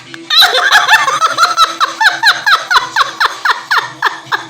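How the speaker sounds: long high cackle of laughter; long helpless laugh. Real, not acted. Fed up with hiccups, a long high helpless laugh. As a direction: lose it completely for a few seconds.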